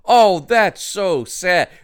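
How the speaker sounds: drawn-out, high, loud lament; dismayed sympathy. Real, not acted. Reacts to dog being put down.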